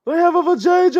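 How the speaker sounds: very high, loud mimicked outburst; mock shriek. Co-host mimicking woman in clip, mock shriek. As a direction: squeal it in hammed-up outrage.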